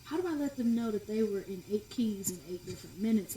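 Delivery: hushed, wondering aloud; muttered to yourself; quiet inner thought. Retold or imitated. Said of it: "you're like".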